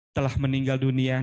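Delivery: low, solemn death notice; mournful announcement. Shown as a demonstration. Trainer shows how news of grief sounds good, mournful and low. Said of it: "informasi duka".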